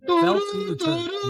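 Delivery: high, slow siren whoop held out long; imitated siren wail. Remembering the dispatch alarm that called officers, siren wail. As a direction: wail it like an old police radio siren.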